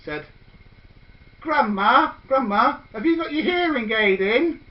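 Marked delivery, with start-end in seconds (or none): 1.4-4.6 put-on higher, eager little child's voice; a small child calling to granny; lightly voiced little girl; youngest child. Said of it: "The youngest child said".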